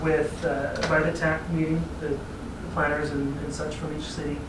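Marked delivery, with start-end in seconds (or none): none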